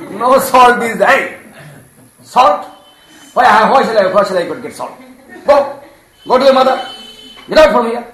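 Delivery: put-on loud, high, exasperated scolding of a child; old devotee angrily sends the boy off; angry scolding. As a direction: shout him out of the hut. Sanatana scolds the child deity.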